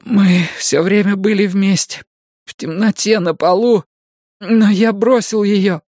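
put-on high, frantic young man pleading; desperate youth begging for help; Mikael panicking. Mikael confesses.

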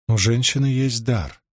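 usual speaking voice

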